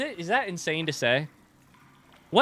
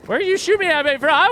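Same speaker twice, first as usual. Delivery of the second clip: high loud demanding yell; frustrated shout; 13 semitones higher, 8 dB louder than usual. Real, not acted. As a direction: yell it at the unseen shooter. Shot at by unseen enemy.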